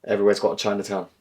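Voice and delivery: usual speaking voice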